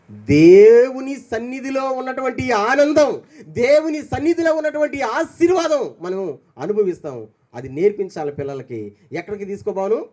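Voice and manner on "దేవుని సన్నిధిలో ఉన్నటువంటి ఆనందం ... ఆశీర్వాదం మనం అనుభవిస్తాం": slow, rising, louder sermon voice; fervent preaching. Acted. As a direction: build it up like a preacher. Preaching build-up.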